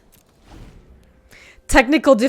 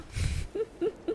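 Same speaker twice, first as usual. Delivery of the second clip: airy high-pitched giggle; high breathy giggle; 12 semitones higher, 12 dB quieter than usual. Real, not acted. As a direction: giggle sheepishly. Laughs after admitting buying.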